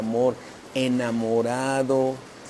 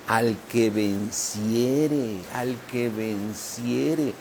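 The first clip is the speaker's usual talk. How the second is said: slow solemn; much slower than usual. Acted. Solemn scripture repetition.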